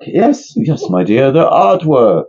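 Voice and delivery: put-on a lightly done posh-ish accent, high start; mock genteel accent, half done. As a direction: slip into a playful put-on accent. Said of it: "I can't switch accents that quick".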